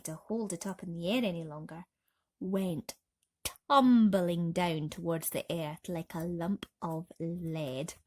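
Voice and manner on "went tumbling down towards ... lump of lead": usual speaking voice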